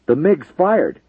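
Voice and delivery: loud alarmed radio call; shouting. A narrator's reading. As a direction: shout the warning over the radio. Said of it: "the radio controller shouted over guard".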